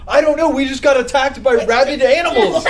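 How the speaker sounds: high, loud retold outburst; acted-out exasperated exclamation; frantic reply. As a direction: blurt it out, on the edge of laughter. Retold panicked reply to the school.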